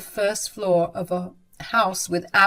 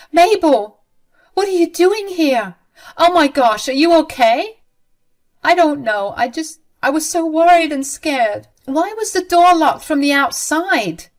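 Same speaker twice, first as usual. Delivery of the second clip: high, louder worried questions; alarmed shock; 6 semitones higher, 5 dB louder than usual. Acted. Bride finds Mabel locked in room, alarmed and worried. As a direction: rush to her, alarmed.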